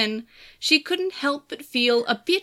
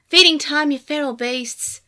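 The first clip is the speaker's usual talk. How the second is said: louder, playful call; loud announcement; 4 semitones higher, 5 dB louder than usual. A narrator's reading. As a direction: burst in announcing dinner. Barges in with food.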